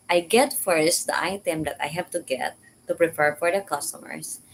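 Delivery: ordinary talk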